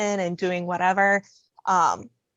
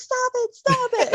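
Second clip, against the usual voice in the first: high-pitched mock yell; retold yelling. Passenger retelling her yells at the rider behind, high mock yell. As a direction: yell it high, without full volume. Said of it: "And I kept yelling".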